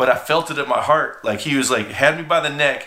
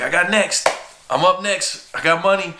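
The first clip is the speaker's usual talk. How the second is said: calling next; 6 semitones higher than usual. Retold or imitated. Kid with new cash calling the pool table, proud. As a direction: call out next game like you're flush.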